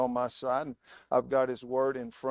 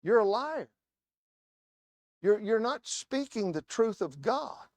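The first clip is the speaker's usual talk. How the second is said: quoting a rebuke; 6 semitones higher than usual. Retold or imitated.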